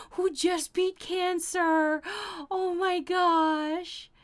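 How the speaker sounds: high, drawn-out gush of emotion; slow, high-pitched coo, overcome by good news; touched gushing. Real, not acted. Streamer reading a viewer's senior dog story, touched gushing.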